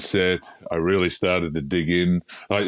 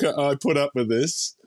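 ordinary talk, nothing marked

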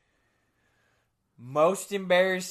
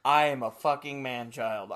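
normal talking voice

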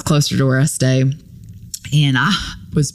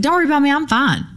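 high, fast mock reassurance; pretend-fine reassurance, lightly voiced; brushing it off; 11 semitones higher, faster than usual. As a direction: rush out a cheery "I'm fine". Reassuring the owner while hurt.

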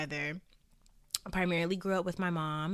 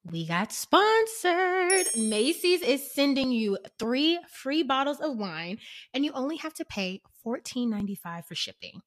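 excited ad read; 6 semitones higher, 6 dB louder than usual. Acted.

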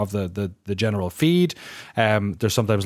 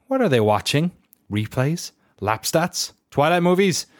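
ordinary talk

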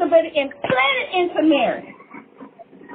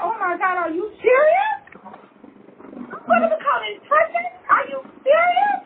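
hammed-up stunned reaction; shocked disbelief; 7 semitones higher than usual. Joseph reacting to Mary's pregnancy, shocked rising disbelief. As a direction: pitch climbing higher with each question. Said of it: "And then he said to Mary".

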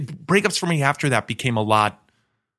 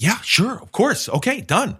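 quick-to-agree higher voice; eager agreement; 6 semitones higher than usual. Retold or imitated. Man accepting a date's invitation, eager and higher. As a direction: jump at the offer, pitched up. Acts eager yes on phone.

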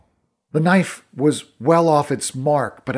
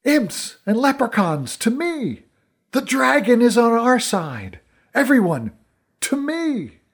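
high, ringing battle call; rally the troops to your side; rallying shout; 10 semitones higher than usual. A narrator's reading. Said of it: "I just got to my feet and shouted".

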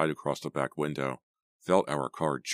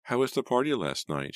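gently voiced mother, higher pitch; mother asking; 9 semitones higher than usual. A narrator's reading. Mother asking daughter about last night's party, higher motherly voice. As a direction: lift the voice into a motherly question.

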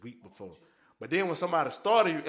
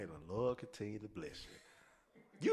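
sweetly condescending; 5 semitones lower, 9 dB quieter, much slower than usual. Retold or imitated. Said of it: "I used to love telling people".

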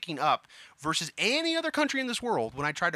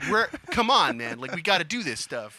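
louder, higher pleading with a bandmate; hammed-up exasperated call through a door; mock urging; 3 semitones higher, 5 dB louder than usual. Friend banging on the door to get him to practice, mock urging. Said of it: "I have to come banging on the door".

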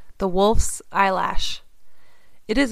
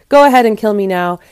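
loud, quick outburst; crying out; 7 dB louder, faster than usual. A narrator's reading. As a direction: cry it out fast and dramatically. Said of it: "she cried".

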